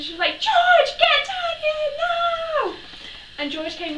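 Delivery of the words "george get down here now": very high, loud shrieking summons, slowing down; screamed call. Retold or imitated. Mum yelling for her son to come down, screaming. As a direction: scream up the stairs for George. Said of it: "And she screamed".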